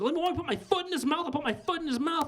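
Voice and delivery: high, lightly voiced lady in stilettos, demanding; mock dominatrix. Mocking woman voice from porn clip.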